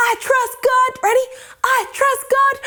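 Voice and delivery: high, squeezed declaration; tense, high-pitched rallying line; holding on tight. Shown as a demonstration. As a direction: say it high as if straining to hold tight. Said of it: "really tight like this".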